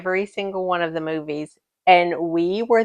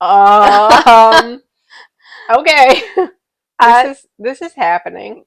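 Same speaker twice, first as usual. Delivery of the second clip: awkward shock; 8 dB louder, slower than usual. Retold or imitated. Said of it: "I'm sitting here at work like".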